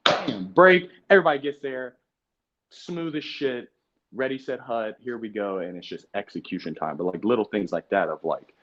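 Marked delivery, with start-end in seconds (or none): 0.0-5.6 hyped break. Retold or imitated. Describing a crisp break.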